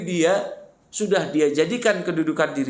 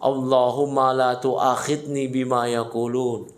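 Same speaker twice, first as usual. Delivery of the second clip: slow Arabic du'a recitation; measured, drawn-out prayer; 7 semitones lower, slower than usual. Shown as a demonstration. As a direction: recite slowly and lower, for listeners to learn. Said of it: "doa ini, Pak".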